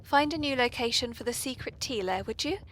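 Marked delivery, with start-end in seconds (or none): none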